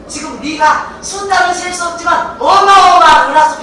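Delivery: high, loud sweeping declaration; grand promise. Acted. God showing Abraham the stars, loudly proclaiming.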